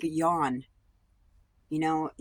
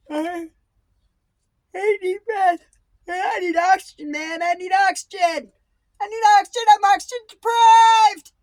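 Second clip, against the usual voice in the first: much higher, much louder desperate yelling; body shouting for air; 13 dB louder than usual. Acted. The body demanding air from its owner, frantic shout. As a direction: scream for oxygen like you're suffocating. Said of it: "Your body will say".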